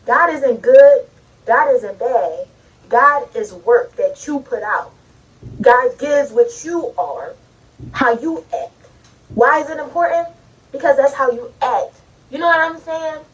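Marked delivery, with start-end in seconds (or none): 0.1-8.7 slow and weighty. Real, not acted. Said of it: "slow emphatic teaching".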